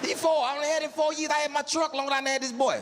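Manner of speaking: incredulous rant; exasperated. Real, not acted. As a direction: throw up your hands in disbelief. Exasperated remark about knowing son.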